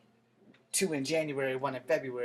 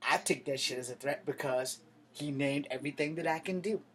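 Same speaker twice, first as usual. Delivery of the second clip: ordinary talk, nothing marked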